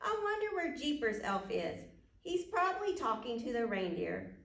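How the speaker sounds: usual speaking voice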